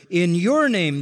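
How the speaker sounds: "your" lifted above "in" and "name"; stress on YOUR. Shown as a demonstration. Preacher repeating the verse's phrase, emphatic "your". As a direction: make "your" the emphatic word, pitch jumping up. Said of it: "the you is emphatic each time".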